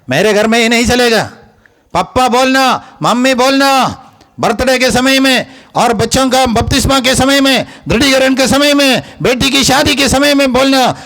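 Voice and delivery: high-pitched, insistent preaching; high, ringing exhortation; preaching at full force. Real, not acted.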